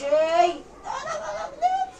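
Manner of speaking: very high, slow squeal on "انا ما بكدبش"; high squeaky denial. Acted. Influencer mocking herself with a squeaky high denial. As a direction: squeal "بكدبش" out slowly, laughing. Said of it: "هناء صريحه وست ستة ودغري".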